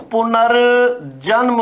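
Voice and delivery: पुनर्जन्म said slowly and clearly; slow correct pronunciation. Shown as a demonstration. Teacher to class, slow correct word. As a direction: model पुनर्जन्म carefully for the class. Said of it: "इसका शुद्ध रूप क्या होगा".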